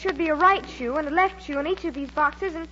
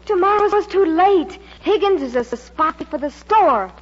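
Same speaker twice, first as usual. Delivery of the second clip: loud, raised-voice alarm; urgent warning; 6 dB louder than usual. Acted. Mary warns Joe that Higgins is a spy, urgent and loud. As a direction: press the warning home forcefully.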